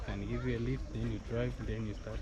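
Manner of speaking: normal talking voice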